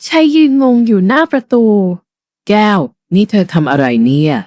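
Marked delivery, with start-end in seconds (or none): 2.1-4.3 low, slow bewildered man's voice; puzzled husband, drawn out low; husband baffled by his wife, low and slow; confused husband. Acted.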